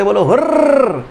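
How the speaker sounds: drawn-out cheering hurr. Shown as a demonstration. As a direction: lead the class in a cheer. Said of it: "मैं बोलूँ हुर्र". (Class से जोश में हुर्र बुलवाना)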